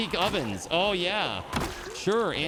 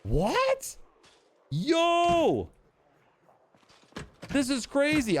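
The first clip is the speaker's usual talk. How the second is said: high excited yelp of surprise; shocked and thrilled, voice jumping up; surprised; 10 semitones higher, much slower than usual. Real, not acted. Sudden find of warehouse.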